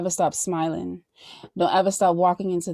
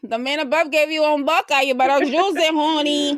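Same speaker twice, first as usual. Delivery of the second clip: sassy; 8 semitones higher, 5 dB louder than usual. Acted. Sassy preaching to guest.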